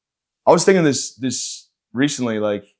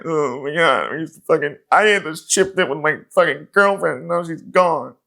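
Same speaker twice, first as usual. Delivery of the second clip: high, hammed-up weepy wail; exaggerated tearful breakdown, pitched up; mock crying; 5 semitones higher than usual. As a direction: bawl through the words, dragging them out. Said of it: "I'm just crying my ass off".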